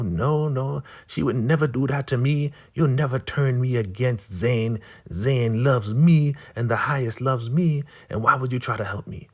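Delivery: high, insistent denial as an elder woman; Marva. Retold or imitated.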